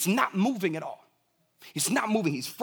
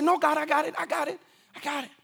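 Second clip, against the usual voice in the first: put-on high, stubborn toddler voice aimed at God; childish refusal; 9 semitones higher, faster than usual. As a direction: refuse God like a proud little kid. Preacher plays himself like toddler.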